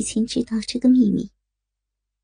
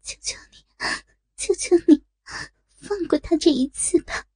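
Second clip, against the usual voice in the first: trembling voice; 6 semitones higher than usual. Acted. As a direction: beg with a quivering voice. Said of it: "颤声道".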